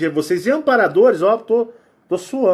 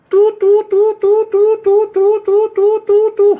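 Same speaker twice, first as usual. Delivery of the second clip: much higher, louder tu tu alarm beeps; siren imitation; 13 semitones higher, 7 dB louder, slower than usual. Warning of reptilians in the area, siren-like beeping. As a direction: beep like a siren.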